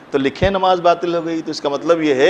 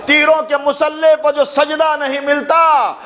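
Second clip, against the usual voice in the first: impassioned preaching climax; 11 semitones higher than usual. Real, not acted. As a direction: build to a rousing, chanted pitch for the congregation. Preacher builds to rousing climax.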